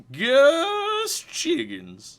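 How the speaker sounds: put-on high, slow, creaky old man. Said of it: "where my old man voice comes from".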